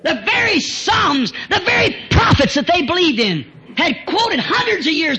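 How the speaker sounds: slow, high, loud shout; preacher crying out to the church; preaching shout. Real, not acted. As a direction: hammer it out slowly. Preaching climax.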